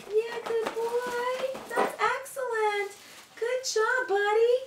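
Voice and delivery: high, slow, sing-song praise for the dog; high drawn-out sing-song praise for a good dog; high happy praise. Real, not acted. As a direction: croon delight at the dog. Praising dog after place command.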